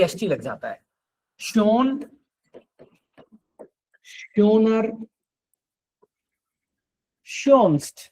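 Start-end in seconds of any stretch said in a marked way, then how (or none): 1.4-8.0 शोन, शोनर, शोन्स्ट said slowly and distinctly; careful German forms. Shown as a demonstration. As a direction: model each form carefully. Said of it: "ये positive, ये comparative". (धीरे-धीरे उच्चारण)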